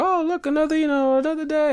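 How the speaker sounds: high mocking whiny voice; mock whiny people. People complaining about another day, high mocking whine. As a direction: parody people dreading the day. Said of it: "People are like".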